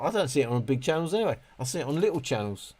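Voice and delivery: usual speaking voice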